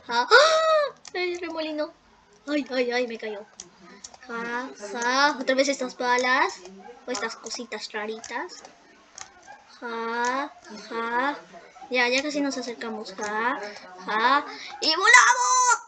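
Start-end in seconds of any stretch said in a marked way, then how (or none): 0.3-0.9 sudden high yelp of surprise; a sharp high cry as the whirlpool grabs her; startled yelp. Real, not acted. Player caught by the whirlpool, yelps high. 14.8-15.8 high, loud cry of joy; excited shout. Real, not acted. Gamer flying off a jump, shouts high with glee. Launched into the air in game.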